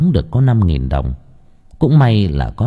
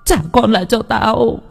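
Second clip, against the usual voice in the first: even higher second scream; 13 semitones higher than usual. Acted. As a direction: shriek harder the second time. Repeated scream.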